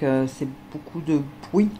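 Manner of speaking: normal talking voice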